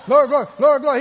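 loud, rapid-fire mock chant. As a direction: rattle it off loudly, tumbling over the words. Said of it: "Say it faster".

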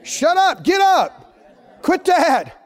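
loud, high-pitched commands to oneself; forceful preacher's rebuke; scolding shout. Acted. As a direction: shake yourself awake with shouted orders. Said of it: "shake it and say".

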